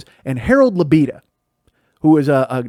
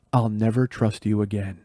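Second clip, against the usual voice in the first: cold quiet threat; 6 semitones lower, 7 dB quieter than usual. Retold or imitated. Mom staring her son down, quiet and cold. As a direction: let it drop with cold disappointment.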